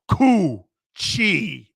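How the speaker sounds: "Coochie" with a loud, punched "Cooch"; stress on first syllable. Shown as a demonstration. Host repeats the first-syllable stress, loud. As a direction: lean hard on "Cooch" in "Coochie". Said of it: "First syllable".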